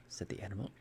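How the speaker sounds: ordinary delivery, nothing marked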